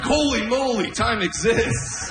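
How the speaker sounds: high, slow, awestruck; mock amazement. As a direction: marvel like time was just invented. Comedian amazed at his watch.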